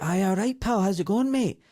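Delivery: put-on matey Glasgow greeting in a broad Glaswegian accent; thick Glaswegian accent. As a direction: imitate your younger self in thick Glaswegian. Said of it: "because I did speak like".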